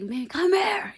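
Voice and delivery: yelling across the club. Retold or imitated. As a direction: holler at her across the room. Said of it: "yelling across the motherfucking Tootsies".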